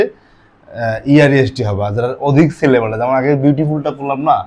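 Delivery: ordinary talk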